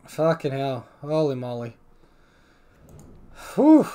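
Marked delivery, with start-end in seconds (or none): 3.5-3.9 relieved whew. Real, not acted. Relief after a win.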